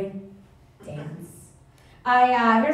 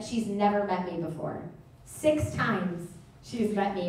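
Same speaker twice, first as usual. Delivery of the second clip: ordinary talk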